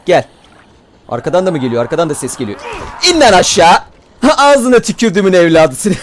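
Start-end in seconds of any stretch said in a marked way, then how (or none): 3.1-6.0 laughing taunt. Real, not acted. Zombie falls, he laughs.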